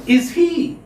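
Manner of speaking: high rising Is he? with a long E; astonished Is he? to the teacher; surprised question. Shown as a demonstration. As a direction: ask it in disbelief, stretching the E. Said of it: "so you prolong that E sound".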